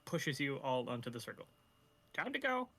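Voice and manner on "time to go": high sing-song NPC voice; a cheery high call to go. Acted.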